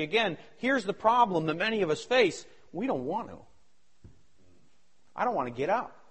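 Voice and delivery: ordinary talk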